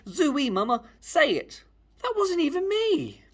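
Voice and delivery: squeaky high-pitched fan, slowing at the end. Acted. Eager fan pestering the family at dinner, squeaky high voice.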